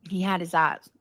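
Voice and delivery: ordinary talk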